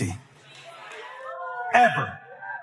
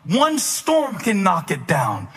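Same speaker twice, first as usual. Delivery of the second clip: usual speaking voice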